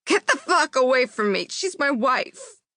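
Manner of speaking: high, strained cry of a desperate husband; anguished shout. Acted. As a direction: shout it at the men holding him back. Husband shouting at police in scuffle.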